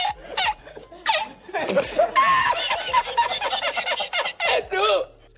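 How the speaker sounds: big high burst of laughter; loud laugh. Real, not acted. Recalls laughing for fifteen minutes.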